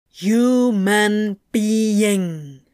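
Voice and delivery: slow, deliberate syllables, loud; very clearly enunciated. Acted. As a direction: spell it out for a machine. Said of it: "as clearly as he could".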